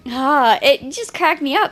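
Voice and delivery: ordinary talk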